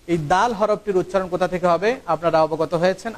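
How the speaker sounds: ordinary talk, nothing marked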